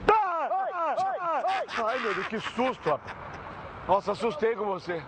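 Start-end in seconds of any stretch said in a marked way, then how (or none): 0.1-1.7 high-pitched yelps of feigned fright; mock frightened yelps. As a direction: yelp repeatedly as if spooked.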